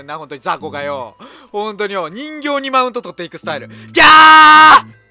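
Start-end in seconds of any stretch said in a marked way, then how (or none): 3.7-5.0 loud long shriek; terrified scream. Real, not acted. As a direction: shriek in terror. Lights go out.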